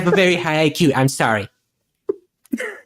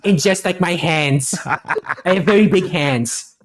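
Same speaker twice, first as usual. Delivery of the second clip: Trump impression. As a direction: brag like Trump at a rally. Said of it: "a response ... from Trump's campaign manager".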